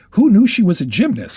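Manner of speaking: playful exclamation of surprise; mock exclamation. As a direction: blurt it out like a shocked reader. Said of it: "something that you don't exclaim".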